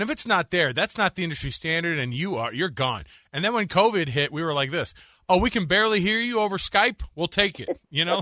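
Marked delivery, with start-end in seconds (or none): 5.3-7.8 mock relieved host. Radio hosts voiced during covid.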